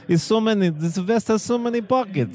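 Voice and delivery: high-pitched, botched Russian accent; Borat. Shown as a demonstration. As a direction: attempt Russian and slide into a comic foreign accent. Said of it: "ended up sounding like Borat".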